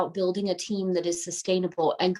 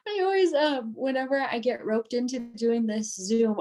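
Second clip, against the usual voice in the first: laughing; 4 semitones higher than usual. Real, not acted. Laughs at her own early call.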